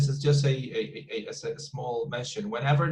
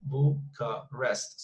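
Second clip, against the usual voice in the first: Bucharest slowly in three separate beats; syllable by syllable; much slower than usual. Shown as a demonstration. Teacher counting three syllables for the class, syllable by syllable. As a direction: break "Bucharest" into Bu, cha, rest. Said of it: "we know it's three syllables".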